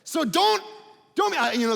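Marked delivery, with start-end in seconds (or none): none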